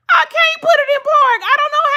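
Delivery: high, loud crying wail; near-tears wail. Retold or imitated. Wife to husband Damon on phone, high loud crying wail. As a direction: cry it out, helpless.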